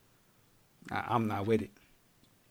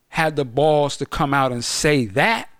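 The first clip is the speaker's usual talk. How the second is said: ordinary talk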